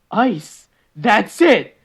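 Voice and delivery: loud and high, a joking breakthrough; mock eureka. Mock eureka over scotch ice.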